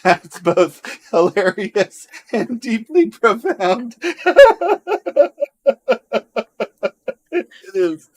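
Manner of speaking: laughing hard through the words; slow and high, laughing. Real, not acted. Laughing at a joke.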